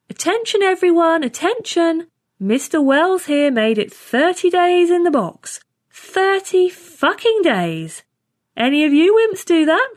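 high, drawn-out shout to the whole room; loud announcement. A narrator's reading. Trainee announcing a comrade's record to the mess, loud and boastful. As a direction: call everyone to attention, loud and high. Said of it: "said in a loud voice".

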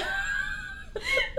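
high shrieking burst of laughter; sudden squealing laugh. Real, not acted. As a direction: cracks up with a squeal. Narrator cracks up.

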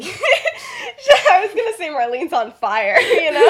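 loud, high, through real laughter; laughing. Real, not acted. Teller amused by Dorcas's bad lie, laughing hard. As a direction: crack up while getting the words out.

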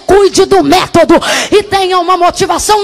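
loud, shouting sermon delivery; preaching at full force. Acted. Preacher shouting over the music to the congregation, full-force preaching.

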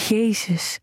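voiced, falling "Jezus" on a gasp of pleasure; aroused gasp. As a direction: let "Jezus" fall out as a moan. Aroused exclamation in scene.

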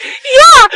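high, loud fake wail; mock crying yell. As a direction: whimper out a shrill yeah. Mock crying reply to the lady.

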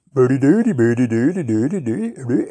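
speaking mock Swedish gibberish to a puzzled lady; talking funny. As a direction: babble nonsense proudly as if fluent. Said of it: "Why are you talking funny?".

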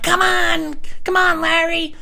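calling out in a high, loud, pulling voice; high coaxing call. Retold or imitated. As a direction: coax him along, way up high and loud. Urging old homeless man to run.

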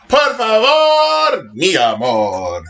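mock-romantic Spanish pleading; hammed-up plea. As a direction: croon it slowly, theatrically begging.

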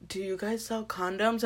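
soft, discreet mock question; hushed customer. Customer repeating himself to the shop clerk, hushed imitation. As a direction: repeat it under your breath.

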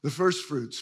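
ordinary talk

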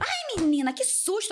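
high-pitched imitation of a startled boy; retold startled cry. Boy startled by the girl opening the bathroom door, mock fright.

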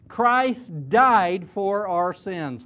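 Christ died for our sins, each word slow and weighted; slow emphatic stress. Acted. Preacher to his congregation, slow heavy emphasis. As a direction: lean hard on every word, very slowly.

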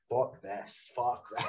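low, soft mock grumble; muttered dismay. Wrestler doing a stupid dance, retold muttered dismay. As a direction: mutter it under your breath. Retells his private dread while dancing.

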